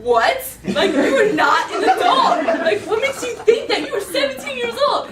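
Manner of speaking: very loud, high incredulous rant; mad dad. Retold or imitated. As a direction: imitate an outraged parent laying into a teen.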